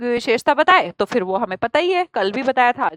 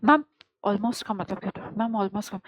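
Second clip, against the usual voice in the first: much softer, timid student asking ma'am; student speaking softly; 4 semitones lower, 9 dB quieter than usual. Retold or imitated. Teacher imitating a child asking ma'am softly in class. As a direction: mumble it like a shy kid in the back row. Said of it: "बस धीरे-धीरे बोले".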